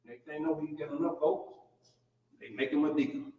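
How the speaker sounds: normal talking voice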